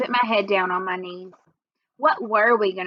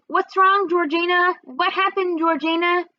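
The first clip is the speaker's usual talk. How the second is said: put-on high, anxious child's voice; a scared younger brother asking; little boy pleading with his sister; worried little boy; 7 semitones higher than usual. A narrator's reading. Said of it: "Toby kept saying".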